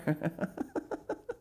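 high, breathless acted laugh; joining laughter. A narrator's reading. Miss Pinchin joins in the laughter, high light laughing. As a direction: laugh lightly along with the group. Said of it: "joined in the laughter".

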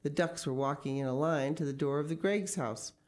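usual speaking voice

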